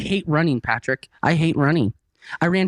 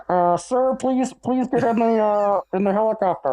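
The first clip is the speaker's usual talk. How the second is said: higher, like a pilot calling over rotor noise; helicopter pilot sound effect; 6 semitones higher than usual. Acted. Pilot calling to a man on his roof, like radio. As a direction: call out as if over a chopper radio. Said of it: "I love the sound effects".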